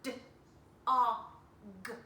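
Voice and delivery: d, o, g spaced out; separate sounds. Shown as a demonstration. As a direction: sound out each letter. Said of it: "if I just look at the sounds".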